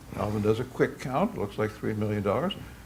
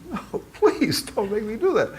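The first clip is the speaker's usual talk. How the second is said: pleading; 10 semitones higher than usual. Retold or imitated. Alvin pleads with God.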